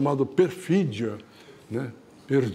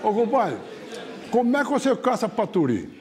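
higher-pitched countryman's question; curious caboclo; 6 semitones higher than usual. Retold or imitated. Caboclo quizzing his hunting buddy, high curious voice. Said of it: "o outro falou assim".

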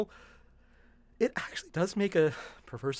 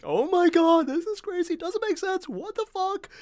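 exasperated imitation; 13 semitones higher, 3 dB louder, slower than usual. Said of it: "He's like".

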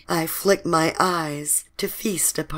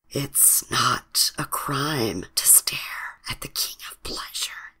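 amused whisper; 4 semitones lower, 6 dB quieter than usual. A narrator's reading. Man to the woman staring at him, amused whisper. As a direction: whisper it teasingly. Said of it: "he whispers, amused".

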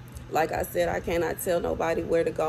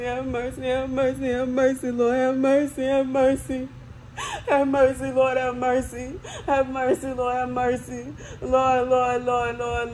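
crying prayer; 5 semitones higher than usual. Real, not acted.

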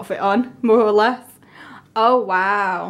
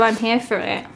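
ordinary talk

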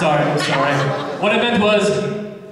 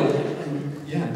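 ordinary delivery

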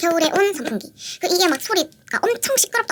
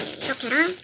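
ordinary delivery

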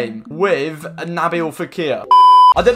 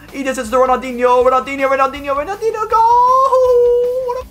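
rising higher and louder to a yelled goal; excited build to goal; 11 semitones higher, 4 dB louder than usual. Real, not acted. Player calling his own goal to viewers, excited yell. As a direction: build the call then scream goal.

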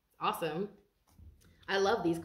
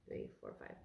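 whisper; 17 dB quieter than usual. Real, not acted. Counting cards, drops to whisper.

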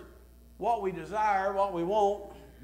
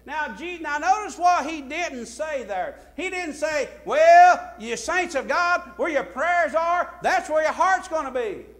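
loud, high mock quote from the pulpit; fervent sermon voice; preaching at full force; 11 semitones higher, 13 dB louder, slower than usual. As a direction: ring it out to the saints. Preacher mocking a false quote.

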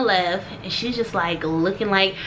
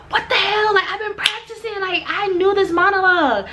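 high, exasperated self-talk; retold outburst of frustration at oneself; frustrated; 9 semitones higher than usual. Blanking on a practised monologue, high mock exasperation. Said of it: "it's so frustrating".

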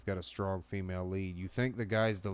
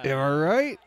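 louder, higher push on right; louder, higher repeat; 7 semitones higher, 11 dB louder than usual. Shown as a demonstration. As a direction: punch the second take harder. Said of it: "repeats the line louder".

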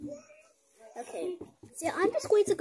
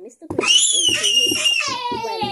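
loud excited reading; 15 dB louder than usual. Acted. Reading loudly to children.

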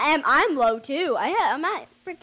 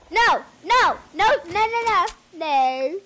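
loud protest; 5 semitones higher, 7 dB louder, slower than usual. Real, not acted. Panicked protest in game.

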